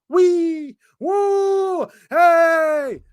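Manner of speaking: hammed-up fake celebration of a feeble tactic; mock cheering. Fan mocks new short corners with fake cheers.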